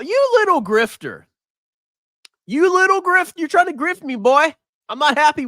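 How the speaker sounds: high, mock-outraged scolding. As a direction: tease him like a cheated shopkeeper. Mock scolding a viewer over currency.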